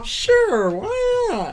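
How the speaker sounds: put-on high, casual childish shrug; eager kid voice. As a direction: answer like a carefree kid. Playing the child's answer.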